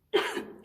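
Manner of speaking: dry cough from dust in the throat. Real, not acted. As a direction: clear a dusty throat with a cough. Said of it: "I've just got dust in my throat".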